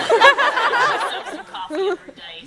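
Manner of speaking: a drawled Brooklyn accent, like she lives on coffee and cigarettes; Brooklyn smoker lady. Shown as a demonstration. Panelist demos her go-to Brooklyn smoker-lady accent, slow Brooklyn drawl. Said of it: "the smoker lady who lives in Brooklyn".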